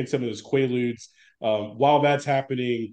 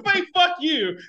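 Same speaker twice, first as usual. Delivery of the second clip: mock Don Ameche; slower than usual. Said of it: "He didn't say".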